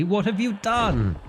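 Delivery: high, alarmed what have you done; horrified, voice shooting up; panicked cry. Acted. Eldritch beast released.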